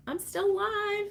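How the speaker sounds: high pitched mock plea; squeaky I'm alive; mock dramatic cry. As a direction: ham up being trapped. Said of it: "I'm like".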